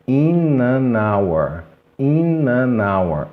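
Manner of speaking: "In an hour" very slow, as i-na-nour; palavras ligadas devagar. Shown as a demonstration. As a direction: draw out "In an hour" as one word. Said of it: "como se fosse apenas uma". ("In an hour" bem lento e ligado)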